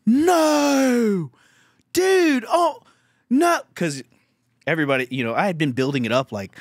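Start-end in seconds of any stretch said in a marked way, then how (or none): 0.1-3.6 shocked. Real, not acted. Recalling a shocking moment.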